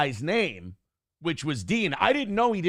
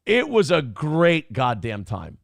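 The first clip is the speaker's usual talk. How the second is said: ordinary talk, nothing marked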